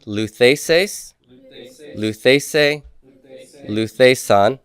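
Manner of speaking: slow, deliberate chanting of the paradigm; teacher chanting endings for students to repeat; drill recitation. Shown as a demonstration. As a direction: lead the class through the forms aloud. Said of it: "Back to the feminine form, everybody".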